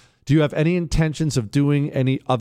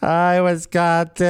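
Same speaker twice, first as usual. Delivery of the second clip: slow, slightly louder gushing mock greeting; mocking Dome's greeting; 4 semitones higher, 4 dB louder, slower than usual. Host mocking the clip's greeting, slow and gushy. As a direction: drag it out like a tipsy suburban mom. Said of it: "She's gotta be hammered".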